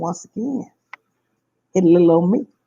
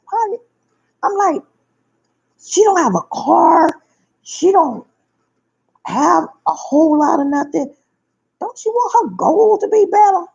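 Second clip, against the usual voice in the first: high retold quote; 8 semitones higher than usual.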